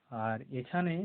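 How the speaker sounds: ordinary talk, nothing marked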